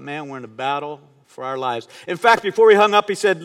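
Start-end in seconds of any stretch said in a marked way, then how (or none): 0.0-1.9 grave inward thought. Retold or imitated. Pastor recalling his thought after the doctor's news, grave and hushed. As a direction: lower and softer, sobered.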